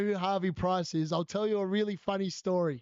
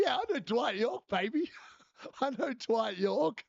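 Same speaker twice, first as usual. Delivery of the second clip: high, gleeful footballer-fan brag; excited boast; 4 semitones higher than usual. Retold or imitated. Teacher retelling his delight at knowing Dwight York, high excited brag.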